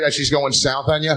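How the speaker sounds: ordinary delivery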